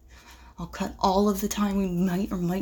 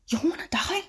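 frantic whisper; 9 semitones higher than usual. Acted. Hiding from shooter.

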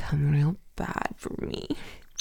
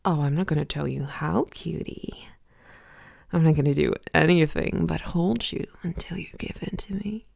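normal talking voice

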